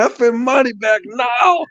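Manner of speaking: high, slow re-enacted demand; retold angry demand. Irate customer retold demanding a refund, high and slow, angry. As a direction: drag out the demand, pitched well up.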